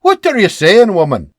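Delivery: loud gruff bark at his wife. Acted. Husband barks loudly at wife's teasing. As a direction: snap it back, loud and high. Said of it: "Beebe barks".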